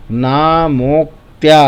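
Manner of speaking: नामोक्त्या as ना-मोक्-त्या, each piece distinct; in three parts. Shown as a demonstration. Teacher demonstrates the split, three clear parts. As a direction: hold ना, stop on क्, release त्या in नामोक्त्या. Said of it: "तीन बार में बोलेंगे".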